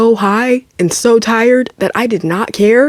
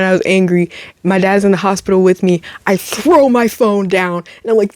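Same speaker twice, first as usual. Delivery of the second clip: ordinary talk, nothing marked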